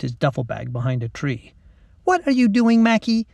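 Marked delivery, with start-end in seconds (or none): 2.0-3.3 high, whiny schoolgirl; exasperated, raised-pitch protest; complaining. A narrator's reading. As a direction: nag your friend impatiently. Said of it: "Lacey complained".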